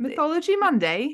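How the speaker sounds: amateur British accent with a rising end. Shown as a demonstration. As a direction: try it in British, lifting into a question. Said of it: "in a British accent".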